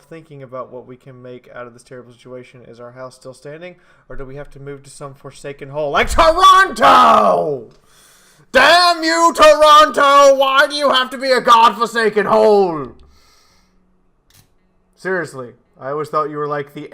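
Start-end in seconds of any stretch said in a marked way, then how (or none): 4.1-13.0 loud, high mock outrage; mock rage. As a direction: rant at the city in fake fury. Mock rant against Toronto.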